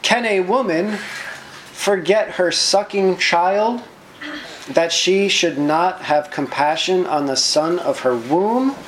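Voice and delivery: lifted, measured Bible reading; scripture reading. Acted. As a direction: proclaim the passage like a psalm. Reads scripture aloud.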